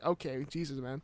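low, soft, backing-off reply; subdued concession. Retold or imitated. What he would have answered if serious, subdued. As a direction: drop low and quiet, conceding.

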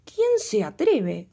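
slow, loud indignant demand; angry queen. Acted. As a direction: spit out the outrage slowly. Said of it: "se enojó la reina".

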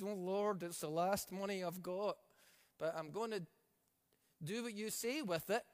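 low, soft, hesitant pleading; a lightly acted giver praying low and soft over their last money; mock tearful prayer. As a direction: pray it like your last coin is leaving you.

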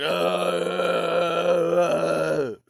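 short mock ape grunts; chest-thumping ape grunts. Apes beating each other up, chest-thumping grunts. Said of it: "thumping their chest".

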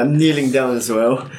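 normal talking voice

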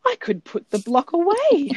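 mother imitating her daughter's happy realization. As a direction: pipe up like a four-year-old with a sudden idea. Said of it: "she stopped crying and she looked up at me and she said".

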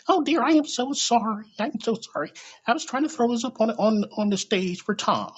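high, fluttery apologetic lady voice. Retold or imitated. The plus size lady apologizing to him, high and fluttery. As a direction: gush sorry in a high lady voice. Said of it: "she's like".

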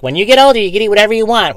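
hammed-up eager child; mock kid. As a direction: kid dreaming about being grown up. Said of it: "you're like".

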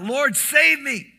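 high, loud, slow plea for rescue; desperate cry. A narrator's reading. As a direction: call out as if sinking. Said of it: "cried out".

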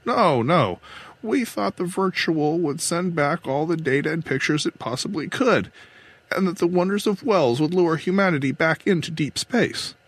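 protest, then explain the hope behind it; control captain, protesting. Acted. Captain denies planning to snatch it away, protesting.

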